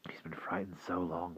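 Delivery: hushed, whispered thought; inner thought. Acted. Will pitying the old man, whispered. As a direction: think it under your breath.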